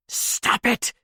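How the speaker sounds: high unvoiced hiss. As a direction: hiss it through quivering lips. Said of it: "The words hissed from her quivering mouth".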